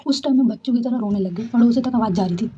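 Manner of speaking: ordinary talk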